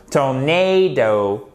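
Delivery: TORNADO slow, long stressed NAY; second-syllable stress. Shown as a demonstration. Teacher models the word for students, emphatic second syllable. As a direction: stretch tor-NAY-do. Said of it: "sur la deuxième syllabe".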